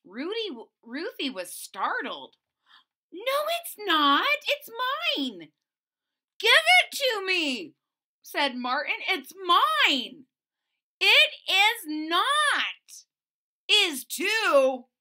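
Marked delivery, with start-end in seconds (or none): 3.1-5.5 put-on high loud defiant girl; angry denial. Children quarrel. 6.4-7.7 put-on high, loud, slow boy; angry demand. Children quarrel. 9.1-10.3 put-on high loud stubborn child; angry insistence. Children quarrel. 11.0-13.0 loud, very slow, defiant; angry denial. Acted. Children quarrel. 13.7-14.9 put-on high loud stubborn boy; angry insistence. Children quarrel.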